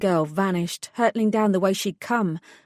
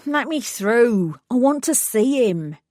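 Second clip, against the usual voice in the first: whining demand; 4 semitones higher, 4 dB louder than usual. Acted. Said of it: "had a whining quality".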